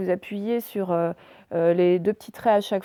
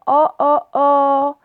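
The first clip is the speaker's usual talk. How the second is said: loud, higher, open "oh" repeated; disappointed "oh"; 5 semitones higher, 7 dB louder, much slower than usual. Acted. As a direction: let out open "oh"s of mock disappointment. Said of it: "il était déçu". (« oh » ouverts, déçus)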